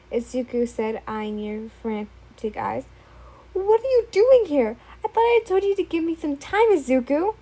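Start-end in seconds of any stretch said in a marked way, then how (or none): 3.5-7.4 higher, louder frightened shout; alarmed shout. Acted. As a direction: yell at the intruder. Startled by intruder, shouts.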